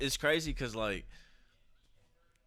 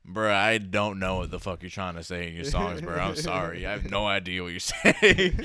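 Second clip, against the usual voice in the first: mock complaint; 11 dB louder than usual. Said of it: "we was talking about grime".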